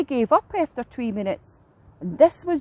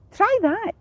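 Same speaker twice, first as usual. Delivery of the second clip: high-pitched gull offer; seagull voice; 9 semitones higher than usual. A narrator's reading. Seagull offers fish to heron.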